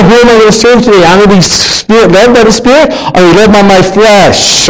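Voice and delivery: normal talking voice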